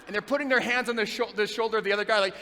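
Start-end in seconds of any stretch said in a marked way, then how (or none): none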